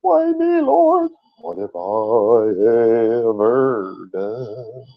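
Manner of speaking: hammed-up wail easing into a slow lament; mock bitter complaint. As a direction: ham up Job's self-pity. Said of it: "Job bitterly complained to God".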